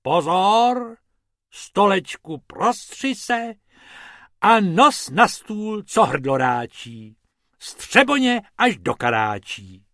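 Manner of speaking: high, loud, slow spell chant; rabbit's magic spell. Acted. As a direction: cast the spell like a cheeky rabbit.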